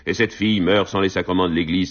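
ordinary delivery

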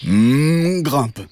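cartoon cow with a loud "hmm"; cow character. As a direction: moo into the invitation. Cow invites mantis aboard.

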